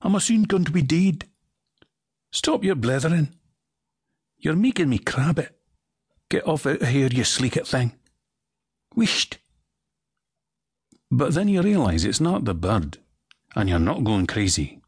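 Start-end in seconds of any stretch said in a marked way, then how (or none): 0.0-9.4 broad Scottish voice, pitched up high; a crabby old Scot scolding; Scottish accent. Acted. A bird scolds the listener in broad Scottish.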